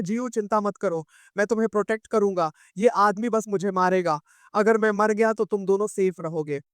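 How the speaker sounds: ordinary delivery, nothing marked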